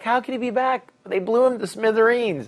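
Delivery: high, loud mock disbelief; incredulous protest. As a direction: act out exasperated disbelief. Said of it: "every time I'd be like".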